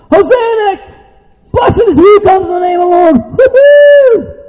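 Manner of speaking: very high, loud jubilant whooping; cheering crowd member. Acted. Acting out same cheering crowd.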